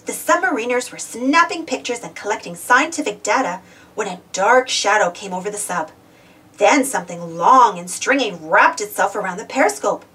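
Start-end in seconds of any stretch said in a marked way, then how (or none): none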